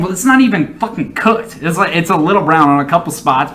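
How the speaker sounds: usual speaking voice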